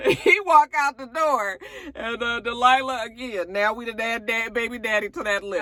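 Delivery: amused. Real, not acted. Amused commentary.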